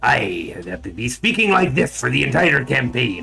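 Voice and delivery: loud, gravelly pirate rasp. Shown as a demonstration. Streamer showing the voice he must keep for four hours, gravelly pirate. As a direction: force out a rough, loud pirate voice. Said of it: "That is gravelly and low and terrible".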